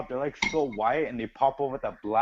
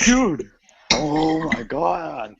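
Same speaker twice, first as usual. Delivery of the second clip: high, louder "dude", then drawn out; exasperated retold outburst; 6 semitones higher, 7 dB louder, much slower than usual. Telling friends how they complained, exasperated. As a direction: groan it out in mock exasperation.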